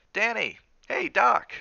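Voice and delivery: put-on high, loud call to a child. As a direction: holler for the boy across a distance. Mother calls her boy from afar.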